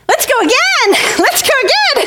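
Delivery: very high, eager chant; acted childish excitement; clamouring little girls. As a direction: squeal it twice, begging for another ride. Girls clamouring for another ride.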